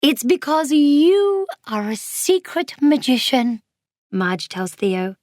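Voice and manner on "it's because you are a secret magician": high, theatrical pronouncement; showy, swooping declaration; grand reveal. A narrator's reading. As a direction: build it up like a magician's announcement. Marge grandly reveals a secret to Theo.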